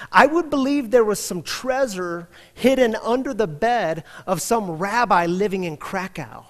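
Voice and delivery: mocking disbelief. Laughing officer mocks the rabbi.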